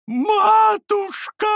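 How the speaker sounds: very high, loud drawn-out wail; Лебедев. A narrator's reading. Лебедев wails to his mistress as the fire flares, wailing. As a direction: wail it out at the top of the voice. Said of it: "Всё ещё вопил Лебедев".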